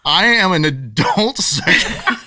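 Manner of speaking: slow, laughing through the words; barely holding back laughter; laughing disbelief. Retold or imitated. Retelling the absurd Facebook ban, laughing slowly.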